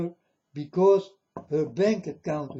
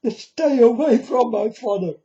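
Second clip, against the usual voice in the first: angry warning; 5 semitones higher, 6 dB louder than usual. Real, not acted. Defiant warning to the deceivers.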